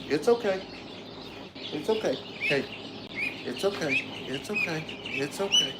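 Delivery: softly murmured reassurance; soothing. Real, not acted. Aside to the duck being shown to viewers, soothing. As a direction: settle a fretful animal with quiet repeats. Settling the duck mid-explanation.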